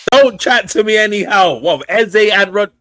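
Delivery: loud, high-pitched boastful shout; excited outburst. Real, not acted. Fan warns co-host off if dream signings happen, excited outburst.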